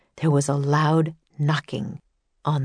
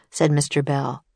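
ordinary delivery, nothing marked

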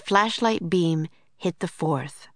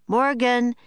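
calling out a name into the dark trees; 6 semitones higher, 3 dB louder than usual. Acted. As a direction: call out to Morgan, a little raised. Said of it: "Jack called".

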